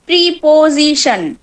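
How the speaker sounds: প্রিপোজিশন broken slowly into pri-po-zi-shan; syllable by syllable. Shown as a demonstration. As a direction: split প্রিপোজিশন into separate, drawn-out syllables. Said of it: "syllable ভেঙে ভেঙে".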